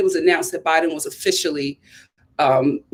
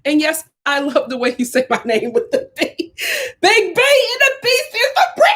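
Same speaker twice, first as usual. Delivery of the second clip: higher, giggly put-on voice, rising to squeaky on "Big B"; playful mock Brittany; 9 semitones higher than usual. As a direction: play a chat viewer, laughing through it. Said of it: "Basically, Brittany said".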